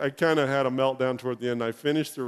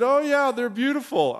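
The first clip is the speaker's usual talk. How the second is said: bright polite reply; 11 semitones higher, 4 dB louder than usual. Retold or imitated. Replying to the missionary.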